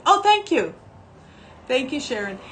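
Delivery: high, loud flattered thanks; surprised, flattered thank you; delighted surprise. Real, not acted. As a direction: gush at the compliment. Reacting to compliment.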